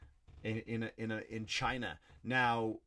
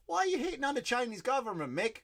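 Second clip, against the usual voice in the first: high put-on voice asking a mock question; a hint of a puzzled fan; lightly voiced questioner; 12 semitones higher than usual. Comic voicing an audience member, a lightly voiced questioning tone.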